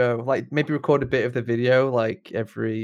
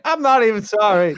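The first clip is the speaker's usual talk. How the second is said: defiant; 9 semitones higher, 6 dB louder than usual. Pretend. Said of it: "and you're like".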